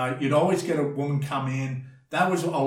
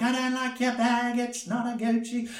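much higher, mocking a bitchy woman; catty woman; 10 semitones higher than usual. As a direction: sneer about the bag in a high girly voice. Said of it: "women will yell at each other and be like".

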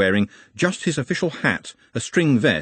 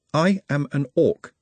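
usual speaking voice

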